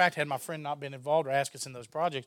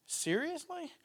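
high-pitched incredulous question; retold disbelief; 14 semitones higher, much slower than usual. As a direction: act out being taken aback, voice lifting high. Retold amazed reaction to news.

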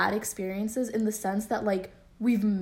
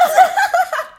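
loud high laugh; 13 dB louder than usual. Real, not acted. Laughing at the memory.

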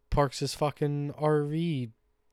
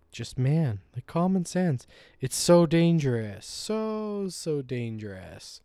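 louder, higher, firm telling-off; angry manly voice; 5 semitones higher, 7 dB louder, slower than usual. Retold or imitated. Telling off the neighbor in his protective voice, louder and firmer. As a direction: lay down the law like a protective dog owner. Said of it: "use my angry voice, my manly voice".

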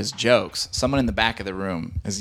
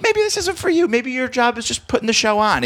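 mocking bouncer; 13 semitones higher than usual.

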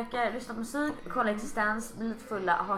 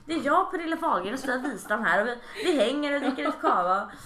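tipsy self-mocking; 7 semitones higher, 6 dB louder than usual. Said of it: "Jag bara".

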